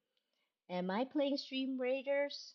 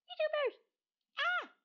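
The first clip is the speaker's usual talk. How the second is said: squeal. Real, not acted. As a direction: squeal like a fan. Builds to a squeal.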